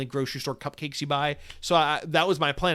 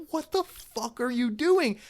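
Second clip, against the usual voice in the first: outraged; 9 semitones higher, slower than usual. Retold or imitated. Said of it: "I'm like".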